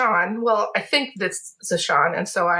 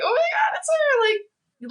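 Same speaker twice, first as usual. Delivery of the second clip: very high fangirl squeal; tearful starstruck squeal; much faster than usual. Acted. Fan recognizing their idol from afar, tearful squeal. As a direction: squeak it out, overcome at seeing your idol. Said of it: "I just start crying 'cause I'm like".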